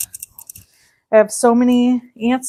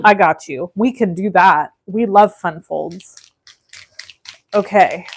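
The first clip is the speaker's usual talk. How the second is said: ordinary talk, nothing marked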